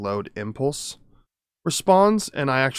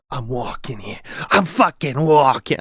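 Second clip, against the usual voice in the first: mock indignant New Yorker, building louder; hammed-up New York City accent; 3 dB louder than usual. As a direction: protest like a New York pedestrian. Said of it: "that's New York City".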